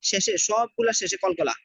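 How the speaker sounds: slow melodic tajweed chant; Quran recitation. Shown as a demonstration. Teacher reciting for students in slow tajweed chant. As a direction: recite slowly, drawing out each vowel.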